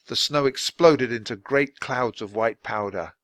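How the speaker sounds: ordinary delivery, nothing marked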